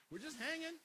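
nervous laugh, then a high, loud evasive dodge; evasive guy. Retold or imitated. Evasive guy asked how serious his dating is, laughing nervously. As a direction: brush it off like a guy dodging commitment. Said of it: "and he's like".

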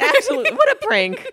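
high, laughing sarcastic; mock cheerful sarcasm. Sarcastic joke about the ending.

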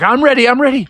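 high, eager ready call; eager Froggy. Acted. Froggy holding the net for dad, high eager. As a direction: bounce with readiness. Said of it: "And Froggy's like".

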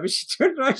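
ordinary delivery

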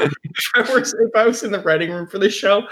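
ordinary talk

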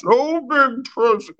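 very high silly put-on voice; high mock Santa. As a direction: squeak it out in a goofy voice. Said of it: "like a fucking drunk Spaniard".

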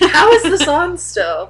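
high, loud, drawn-out retold question; mock disbelief. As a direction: ask it in exaggerated astonishment. Retells disbelief at the old commercial.

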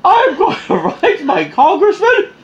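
high, slow, laughing outraged demand; mock angry shout. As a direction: demand his name, hammed-up. Mock irate customer rant.

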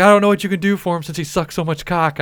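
high, loud mocking coworker; heckling. Coworker heckling during the dentist call, high and loud. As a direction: shout the taunt over the call.

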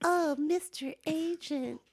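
purring plea to Mister Agent for a contract; sultry pleading. Acted. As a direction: beg the agent coyly and softly.

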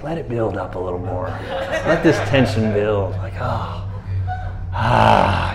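low, relaxed, savouring the moment; comfortable. Retold or imitated. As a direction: settle into the tension with long sighs. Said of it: "comfortable in it".

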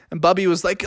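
usual speaking voice

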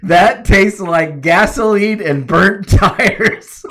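loud, slow, laughing shout of disgust; mock outraged yell. Retelling the fire chief spitting out the beer, laughing mock yell. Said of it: "and says".

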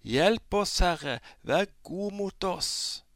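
high, loud, desperate shout. Acted. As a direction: yell out, pleading harder. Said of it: "ropte de bare enda høyere".

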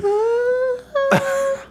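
very high wordless falsetto note; thin sustained squeal of a note; high note attempt. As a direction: reach for an impossibly high note. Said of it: "That's hard to hit".

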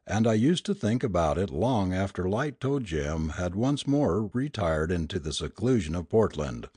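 plain reading voice

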